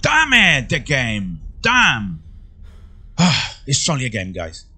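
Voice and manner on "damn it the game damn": sharp, much higher, louder curse; frustrated curse. Real, not acted. Gamer to his viewers after a setback, a loud frustrated curse. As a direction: blurt it out in real annoyance.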